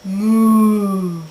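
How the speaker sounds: long, low, loud moo; cow moo. Pretend. Playing animal noises as a cow, mooing. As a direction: moo like a cow, drawn out and held. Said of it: "I am a cow".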